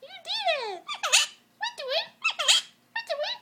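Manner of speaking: put-on high, bright baby talk to a bird; high pet voice. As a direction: coo up high at the parrot.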